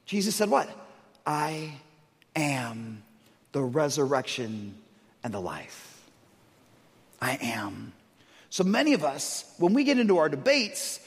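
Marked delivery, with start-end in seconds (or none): 1.2-6.0 hushed reverent quote. Acted. Quoting Jesus, slow and hushed. 7.2-7.9 breathy whispered I am; hushed awed whisper. Acted. Whispered echo of quote.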